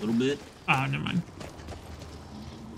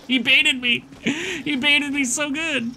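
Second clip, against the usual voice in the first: high, loud outburst after laughing; loud high-pitched exclamation, drawn out at the end; amused outcry; 11 semitones higher, 5 dB louder, slower than usual. Real, not acted. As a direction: cry out, delighted at being tricked. Amused outburst at being tricked.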